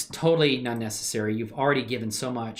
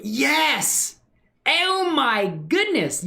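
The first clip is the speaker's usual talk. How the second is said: thrilled; 11 semitones higher, much slower than usual. Real, not acted. Sees viewer watches Dust shorts.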